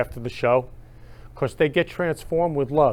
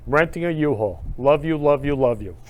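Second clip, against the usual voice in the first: usual speaking voice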